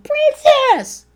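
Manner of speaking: high, loud holler across a room; mock-bellow for someone across a crowded party; imitated screaming. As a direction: imitate a husband yelling from afar. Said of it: "he screamed across the room".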